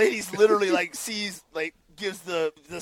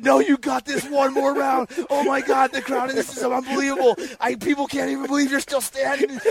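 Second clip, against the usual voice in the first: higher, breathless with excitement; amazed pep talk; 6 semitones higher than usual. Retold or imitated. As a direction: hype up the beaten fighter in disbelief. Randall amazed, urging him on.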